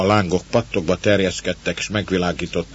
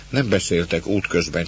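ordinary delivery, nothing marked

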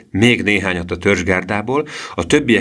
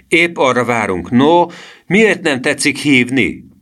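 put-on higher, goading boy's reply; raised, daring tease; cheeky taunt; 7 semitones higher, 4 dB louder, slower than usual. A narrator's reading. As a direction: goad him with mock politeness. Boy taunts the threatening man.

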